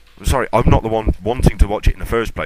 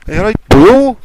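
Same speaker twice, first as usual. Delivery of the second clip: loud, higher put-on Welsh greeting; bad Welsh accent; 12 semitones higher, 7 dB louder than usual. Greeting Chris from Swansea, in a terrible Welsh accent. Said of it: "Sorry, my accents are terrible".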